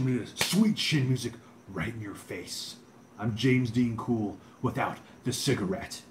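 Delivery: wrestler promo, names drawn out; hammed-up ring challenge, stretched announcement; Shawn Michaels. Threatening Bret Hart, Shawn Michaels wrestler impression. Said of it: "Shawn Michaels impression".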